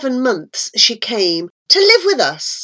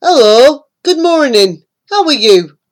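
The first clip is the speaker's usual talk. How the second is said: louder, cheery toad greeting; a hint of a jolly toad; lightly voiced friendly toad; 6 dB louder than usual. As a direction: greet the child with a grin. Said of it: "He smiled and said".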